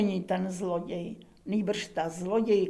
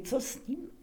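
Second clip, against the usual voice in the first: helpless hushed question; 5 semitones higher, 7 dB quieter than usual. Acted. Kubát's desperate question.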